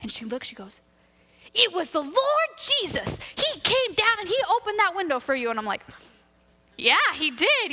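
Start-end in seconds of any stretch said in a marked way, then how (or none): 1.5-5.5 excited exclamation. Retold or imitated. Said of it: "she's all excited because". 6.8-7.7 high-pitched mock-enthusiastic agreement; excited agreement. Playing along with excited woman.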